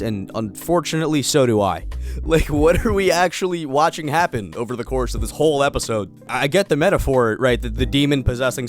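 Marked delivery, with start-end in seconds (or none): none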